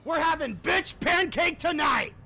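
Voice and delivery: high, loud victory crowing; gloating shout. Real, not acted. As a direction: crow it loudly in triumph. Gloats after winning.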